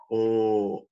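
"O" con labios redondeados; kinema de la O. Shown as a demonstration. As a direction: redondea bien los labios en "O". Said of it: "y que sería una". ("O" redonda y exagerada)

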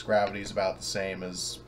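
ordinary talk

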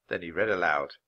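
ordinary delivery

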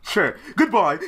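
ordinary delivery

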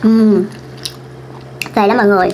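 words around a mouthful; chewing while talking. Real, not acted. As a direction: mouth full of noodles. Seen 1: Chews noodles from earlier bite; crab stick only comes at +3.3.

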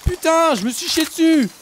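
panicked high screams; scared screaming. Real, not acted. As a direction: shriek in real fright. Crocodile attack scare.

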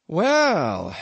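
a loud, drawn-out "Well" from the innkeeper; long-breathed landlord. Acted. Innkeeper answering his lodger's long speech, loud. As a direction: draw a long breath and let it out. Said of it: "fetching a long breath".

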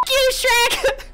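put-on high childlike insult; put-on little boy voice; squeaky kid voice. Little boy curses at Shrek in high voice.

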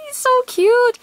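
very high excited squeal; high-pitched gushing delight. Real, not acted. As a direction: squeal over something adorable. Gushing over a cute card.

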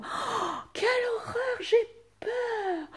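high, thin, frightened cry; fearful exclamation. Shown as a demonstration. Teacher demonstrating fear to pupils, high scared cry. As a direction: gasp it out as if truly scared. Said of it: "exprimer de la peur".

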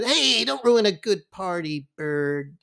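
lightly imitating Raftery's teasing. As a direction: guilt him like Raftery.

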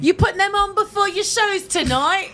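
slow, high-pitched fake British; mock British accent. Teasing the British guest about his rings, mock British accent. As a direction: put on a hammed-up British accent.

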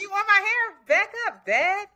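put-on high, sassy little-girl voice; imitating the girl. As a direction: play the bossy kid telling Dad off. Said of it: "She said".

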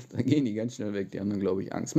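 usual speaking voice

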